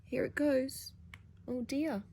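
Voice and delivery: ordinary delivery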